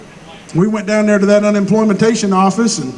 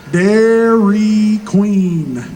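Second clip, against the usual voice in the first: said real slow; much slower than usual. Retold or imitated. Counter man answers the request to say it real slow, extremely slow. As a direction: stretch "Dairy Queen" out, extremely slow. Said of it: "Can you say it real slow".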